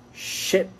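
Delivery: ship with a quick, short I. Shown as a demonstration. Teacher contrasting it with sheep, ship with short I. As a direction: cut the vowel short in ship. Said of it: "c'est un I court".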